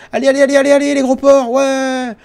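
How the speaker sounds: high loud call, far off; loud hustling. Pretend. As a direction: rush them in like a foreman. Hustles the prisoners to eat. (crier fort pour presser)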